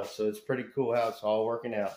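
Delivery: ordinary delivery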